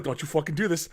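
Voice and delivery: restrained retold threat. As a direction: warn them under control, acting it out. First warning to approaching werewolf.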